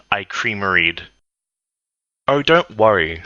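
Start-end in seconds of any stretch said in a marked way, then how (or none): none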